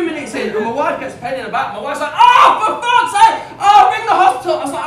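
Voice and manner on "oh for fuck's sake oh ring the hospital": exasperated outburst. Retold or imitated. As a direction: play the fed-up wife snapping at the pain. Wife in pain mid-show.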